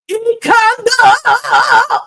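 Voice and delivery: very high, loud, slow stretched word; hammed-up drawn-out word. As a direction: stretch it out absurdly like a crooner. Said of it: "I could see him talking like this".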